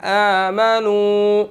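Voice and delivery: आमनु with the final u held for a full second; long madd. Shown as a demonstration. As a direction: stretch the u of आमनु one count. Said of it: "one second लंबा करना है". (आमनु का उ एक second खींचें)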